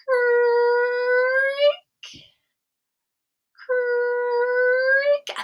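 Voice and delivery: high drawn-out creak; creaking door sound. Retold or imitated. Storyteller imitating the tower door, long high creak. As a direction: stretch it out like a slow creaky door.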